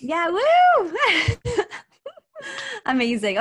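high, loud whoop into a laugh; excited whoop. Real, not acted. Cohost cheering the reframe, whooping and laughing.

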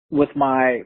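ordinary delivery

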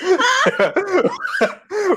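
high-pitched burst of laughter; laughing fit, high and long. Real, not acted. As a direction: crack up laughing.

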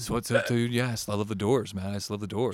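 lightly voiced mellow stoner; Johnson. Imitating his friend talking about music, lightly mellow stoner voice. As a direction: chat with a hint of laid-back stoner. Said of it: "He's, like, really mellow".

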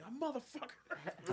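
slow, high drawn-out owl screech; slow high mock screech; owl's cry. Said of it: "He's in the gym like".

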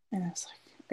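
a hushed whisper under the breath; whispered aside. Real, not acted. Mom whispering at the bedroom door, a hushed whisper. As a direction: whisper it so nobody hears.

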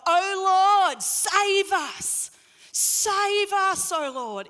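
high, loud pleading cry to God; crowd shouting. Retold or imitated. Festival crowd crying out to God for rain, loud pleading shout. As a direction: call it out like a desperate festival crowd. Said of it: "the whole crowd would start shouting out".

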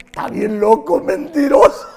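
loud, rising mock protest; mock indignant denial. Boxer denying the knockout to the coach, loud mock indignation.

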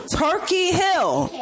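high, slow dramatic reveal; a high, drawn-out mock-horrified Turkey Hill; mock shocked. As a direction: stretch it out like a scandalous confession. Mock outraged American confession.

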